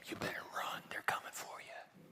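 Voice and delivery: whispered secret warning; urgent whisper. Retold or imitated. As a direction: hush it like a warning passed in secret.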